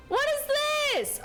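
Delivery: high startled question; sudden shocked squeak; surprised. Real, not acted. Surprise at game screen.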